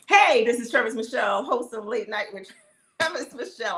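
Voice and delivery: bright talk-show host introduction; host intro. Real, not acted. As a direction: introduce yourself like a late-night host.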